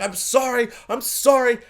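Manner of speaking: high, pleading imitation of crying; mock tearful apology. Crying father embracing his son, mock tearful apology. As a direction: plead it high, lightly acting the tears. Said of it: "and he's crying".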